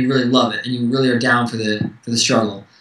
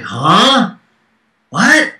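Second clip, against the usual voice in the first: sudden jump up, louder, caught off guard; shocked huh what; 6 semitones higher, 4 dB louder than usual. Retold or imitated. Retelling his reply to the doctor's question, startled disbelief. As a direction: blurt it out in disbelief.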